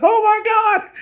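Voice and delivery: high, wailing mock cry; near tears. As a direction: imitate someone about to cry. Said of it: "He's about to start crying".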